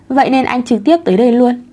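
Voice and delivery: usual speaking voice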